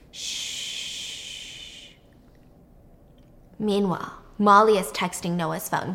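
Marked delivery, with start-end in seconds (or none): 0.1-1.9 drawn-out, very soft hushing; quiet, lingering "shh"; soft shush. Acted. Steve hushes Noah in his embrace, softly.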